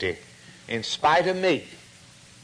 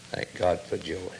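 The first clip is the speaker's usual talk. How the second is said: much softer, lower echo; hushed thanks; 7 semitones lower, 7 dB quieter than usual. Shown as a demonstration. Preacher echoing his thanks for Joab, softly. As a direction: let "God" fall to a murmur. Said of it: "repeated, softer".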